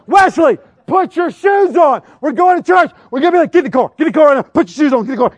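high, loud parental yelling; frazzled dad hollering, re-enacted; acted exasperated shouting. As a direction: bellow orders at a dawdling child. Re-enacts yelling at son to hurry.

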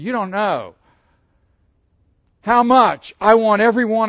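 loud, pleading sermon voice; impassioned preaching. Acted. Preacher pleading with his congregation, loud and fervent. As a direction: plead with the congregation, full force.

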